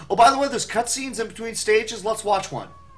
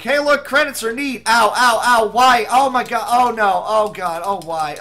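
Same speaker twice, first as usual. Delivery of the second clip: mock pained player; 4 semitones higher, 6 dB louder than usual.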